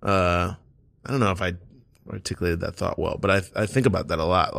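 trailing off. Real, not acted. Unsure he explained it well.

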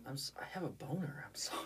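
soft, whispered sheepish apology; whispered confession. Pretend. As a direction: whisper it like an embarrassing secret. Sheepish mock confession aside.

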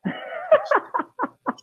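high-pitched laugh; real high laughing fit before answering. Real, not acted. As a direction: burst out in a high, squealing laugh. Laugh at the five-year question.

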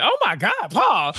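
appalled outburst, lightly replayed; retold shock. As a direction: exclaim in mock disbelief. Own shocked reaction retold.

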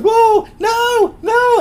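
mock high-pitched screaming. Wrestling fan retelling the manager being carried up the ramp, mock screaming. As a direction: squeal it out in panic, very high. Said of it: "and he's screaming".

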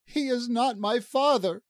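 high-pitched tearful protest; sobbing. A narrator's reading. Lala denies that he is her father, high and sobbing. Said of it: "Lala sobbed".

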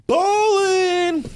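loud, high, drawn-out whoop; mock-triumphant high yell; playful boastful shout. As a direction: holler it like a hype man. Mock boast over small winnings.

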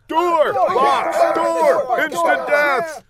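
very high, loud yells; goon shouting. A narrator's reading. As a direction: holler out choices like a rowdy audience. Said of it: "The goon shouted".

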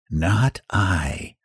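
a lazy cat's brush-off; cat refusing. Acted. Cat refusing the hen, soft lazy voice. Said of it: "And the cat said".

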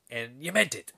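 lightly voiced, restrained Irish accent; deliberate question with a hint of Irish; Irish sergeant checking. Sergeant revisits the insult later, understated Irish accent. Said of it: "then, like, five minutes later".

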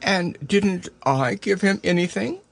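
put-on high, mildly sad child voice; understated wistful question. A narrator's reading. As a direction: ask in a small, hurt voice. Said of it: "asked Christopher Robin sadly".

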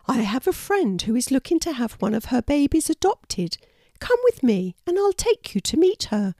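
high-pitched storybook character; high, eager-to-help animal mum; helpful mother wallaby. A narrator's reading. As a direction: offer hope in a high wallaby voice. Wanda offers help in story.

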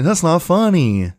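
high, drawn-out sitcom scolding; Jerry Seinfeld. Retold or imitated. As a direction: imitate a comedian scolding the audience. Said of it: "what was the show that he showed up on with Jerry Seinfeld".

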